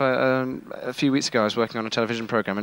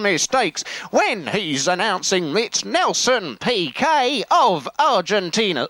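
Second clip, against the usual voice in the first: higher, louder, slow excitable commentator impression; Murray Walker; 7 semitones higher, slower than usual. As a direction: shout it like a frantic race commentator. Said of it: "Murray Walker's fairly loud".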